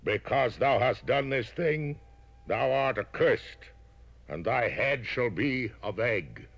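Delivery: high, slow solemn curse; LBJ curse. Acted. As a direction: pronounce the doom deliberately. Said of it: "Then did L B J say unto the intellectual".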